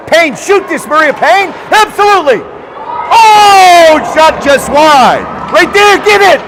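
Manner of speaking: high, loud urging as the chance unfolds; excited play-by-play. Real, not acted. Announcer urging Payne to shoot, excited shouting. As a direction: shout the shot on, then cry out at the miss. Live play-by-play at full pitch.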